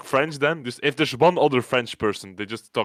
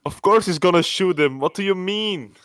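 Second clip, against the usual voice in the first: higher, louder mock exasperation; exasperated retort; 8 semitones higher, 5 dB louder than usual. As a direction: throw it back, fed up and incredulous. Exasperated retort to bossy teammate.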